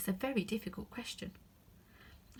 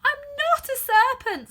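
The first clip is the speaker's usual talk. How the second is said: much higher, louder protest; indignant; 11 dB louder than usual. Acted. Alice protesting to the pigeon, indignant and loud. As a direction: deny it hotly. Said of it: "said Alice indignantly".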